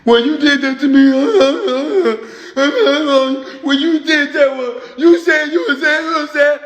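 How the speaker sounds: high, loud, laughing whine; whining beta man. Retold or imitated. Mocking a hurt man whining to his woman, high and loud. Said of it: "you whine a lot".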